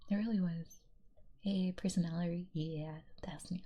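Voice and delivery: ordinary delivery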